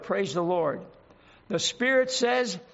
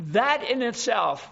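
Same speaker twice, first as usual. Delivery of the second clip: usual speaking voice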